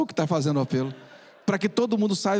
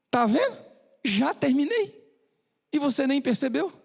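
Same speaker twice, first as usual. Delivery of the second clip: cheerful doctor; 7 semitones higher than usual. Acted. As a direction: show the boy it's over, kindly. Doctor cheerfully tells the boy. (médico animado e carinhoso)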